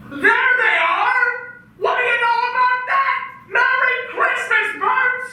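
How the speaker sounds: high, elated shouts; man shouting Christmas greetings in giddy joy; ecstatic joy. Acted. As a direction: laugh it out with delight. Finds the petals, joy.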